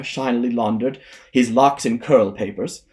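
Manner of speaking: ordinary delivery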